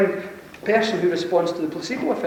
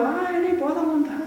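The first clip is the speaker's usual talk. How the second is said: much higher, laughing, breezy swagger; cocky healer; 8 semitones higher than usual. Retold or imitated. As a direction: toss it off like a confident showman. Cocky healer reassures Linda.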